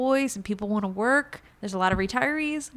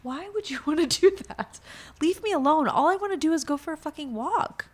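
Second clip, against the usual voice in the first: higher, louder pleading, building to a shout; 7 semitones higher, 4 dB louder than usual. Retold or imitated. Answering a threatening stranger, rising higher to a shout. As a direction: beg them to leave you alone, rising to a yell. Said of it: "I'm like".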